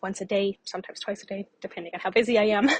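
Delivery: usual speaking voice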